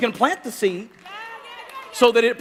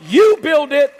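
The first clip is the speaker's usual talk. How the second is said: loud insistence; 5 semitones higher, 7 dB louder than usual. Retold or imitated. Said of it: "He says".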